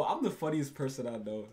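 ordinary talk, nothing marked

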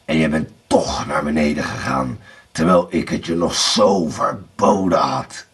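low, threatening villain voice; menacing Bluebeard. A narrator's reading. Blauwbaard accuses Erika of disobeying, low menace. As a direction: accuse her from deep down.

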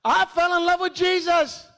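high, fervent preacher's cry; real, full-voiced declaration of devotion; impassioned preaching. Real, not acted. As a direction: cry it out to the whole church.

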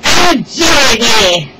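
loud, drawn-out mock wail; hammed-up sobbing. A narrator's reading. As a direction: wail it slowly like a pampered king. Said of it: "The King sobbed".

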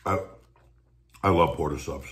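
speaking between bites; talking while chewing. Real, not acted. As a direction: mouth partly full of food. Seen 2: Looking down at sandwich in hands, lips working between bites while talking.

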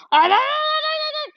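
squealing die die at top pitch; frantic high shriek. Real, not acted. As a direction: shriek it out in a panic. Frantic shooting, high shout.

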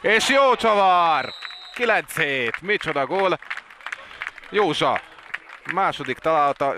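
high, loud, drawn-out goal call; excited hungarian sports commentary. Real, not acted. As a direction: shout the goal with delight. Goal call in water polo.

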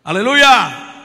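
loud, ringing hallelujah; shouted hallelujah. Real, not acted. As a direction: burst out in praise. Preacher exclaims mid-sermon.

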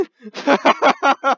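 high, loud cackle; loud burst of laughter. Real, not acted. Host laughs loudly at the guy. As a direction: laugh hard at the absurdity.

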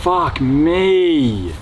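long, drawn-out groan of defeat; slow, fed-up swearing; exasperated. Acted. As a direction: stretch it right out in resignation. Robbed man gives in, exasperated.

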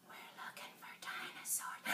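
hushed dinosaur-hunt whisper; secretive, very soft playacting; stealthy whisper. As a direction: whisper as if hunting something hidden.

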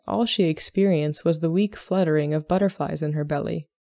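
ordinary delivery

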